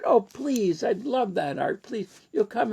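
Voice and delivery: high, eager please; eager pleading. Retold or imitated. Ken begging the visitor to come and read.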